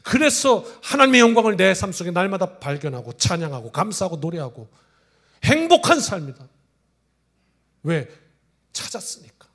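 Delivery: loud, rising proclamation; preaching at full force. Acted. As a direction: build it to the final line. Preacher building to climax.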